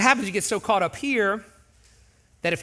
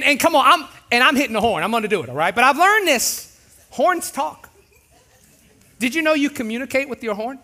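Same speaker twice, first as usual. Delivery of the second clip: ordinary delivery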